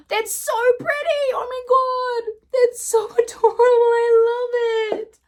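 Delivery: squealing, pitch way up, voice almost breaking; high-pitched excited squeal. Real, not acted. Beauty vlogger gushing over a pretty gloss, in a high, squealing voice. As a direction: gush over it like it's the best gift ever.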